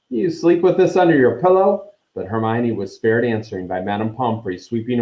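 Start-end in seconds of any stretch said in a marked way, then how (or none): 0.1-1.9 high, loud, incredulous disgust; disgusted outburst. A narrator's reading. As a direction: blurt it out, appalled, voice pitched up.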